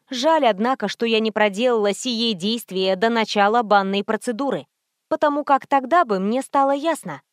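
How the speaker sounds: plain reading voice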